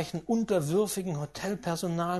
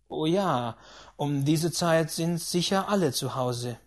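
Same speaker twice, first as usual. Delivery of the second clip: usual speaking voice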